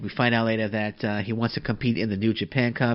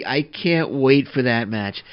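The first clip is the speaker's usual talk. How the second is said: normal talking voice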